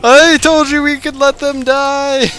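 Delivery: high, loud, slow exasperated yell; mock outraged shout. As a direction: drag out the scolding. Keeps scolding teammate.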